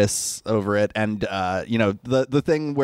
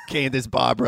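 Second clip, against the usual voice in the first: put-on Boston accent. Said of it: "imagine a thick Boston accent".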